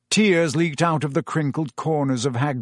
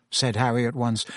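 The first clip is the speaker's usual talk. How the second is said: plain reading voice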